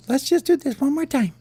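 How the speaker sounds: very high squeaky coaxing; little worm voice. Acted. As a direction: coax in a tiny falsetto. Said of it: "And the wormy said".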